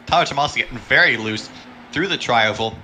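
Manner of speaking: ordinary talk, nothing marked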